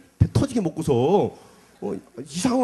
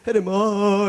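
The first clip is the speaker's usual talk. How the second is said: retold call; 5 semitones higher, 5 dB louder, much slower than usual. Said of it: "워낙 소리 지르니까".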